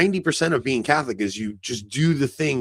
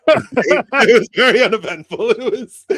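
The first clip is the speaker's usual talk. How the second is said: laughing through it, higher and louder; 10 semitones higher, 9 dB louder than usual. Real, not acted. To his co-host about the dull mass, laughing. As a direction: crack up at your own punchline.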